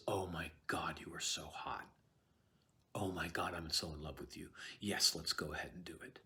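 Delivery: low, soft lovestruck whisper; smitten Macbeth. Retold or imitated. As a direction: whisper it like a suddenly infatuated husband. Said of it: "Macbeth turns on a dime and says".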